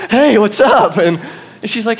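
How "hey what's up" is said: mock casual, high and loud, out of breath; breathless hello. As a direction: fake a calm hello while panting. I'm out of breath.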